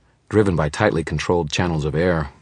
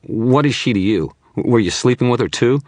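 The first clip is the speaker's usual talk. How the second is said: high, loud contempt; suspicious witness jeering at the wizard; contemptuous jab; 9 semitones higher, 8 dB louder than usual. Acted. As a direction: sneer the question at him. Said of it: "a little contempt in his eyes".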